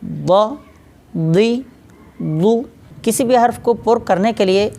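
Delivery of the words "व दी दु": व open, दी lowered, दु with rounded lips; practice drill syllables. Shown as a demonstration. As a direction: spell out व दी दु one by one. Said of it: "होंठों को गोल करते हैं".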